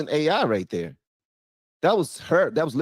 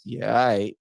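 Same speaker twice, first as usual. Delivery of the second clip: ordinary talk, nothing marked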